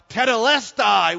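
high, loud acted cry. Preacher voices Jesus's dying cry on the cross, crying out. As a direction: call it out as a triumphant last cry. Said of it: "he cries out".

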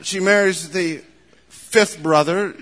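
ordinary delivery

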